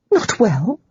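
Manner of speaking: quick, rising startled "Not well?"; startled question. A narrator's reading. Holly startled by housekeeper's news, rising surprise. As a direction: caught off guard, asking back. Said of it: "Holly was startled".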